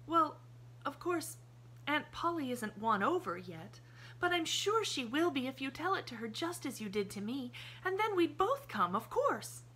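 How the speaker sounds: put-on high child's voice, chatty and sure; a girl happily explaining the plan; cheerful little girl. A narrator's reading. Pollyanna's voice, explaining.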